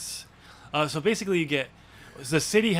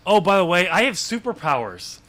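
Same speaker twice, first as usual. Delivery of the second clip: mock casual reveal; 5 semitones higher, 7 dB louder than usual.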